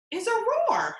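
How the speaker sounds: excited. Acted. Mouse realizing what he needs, excited.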